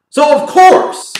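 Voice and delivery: course drawn out, high and slow; mock knowing. Commentator mocking the Redskins, a sarcastic of course.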